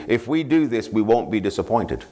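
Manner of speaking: ordinary delivery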